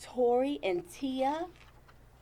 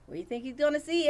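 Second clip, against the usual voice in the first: usual speaking voice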